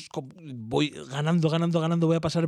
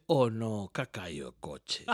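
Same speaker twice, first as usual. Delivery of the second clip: put-on lower, softer childish groan the car fell; toddler dismay; 6 semitones lower, 4 dB quieter than usual. Son disappointed when the car crashes, soft childish groan. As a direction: groan it like a let-down small kid. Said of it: "Y dice".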